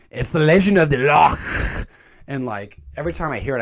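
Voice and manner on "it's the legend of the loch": "loch" ending in a long guttural rasp; loud mock-Scottish line, spitting out "loch"; exaggerated Scottish loch. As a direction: drag out the throaty ch of "loch". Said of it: "says the word loch in this movie says it like".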